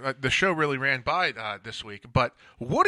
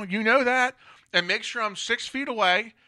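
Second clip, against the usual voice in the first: normal talking voice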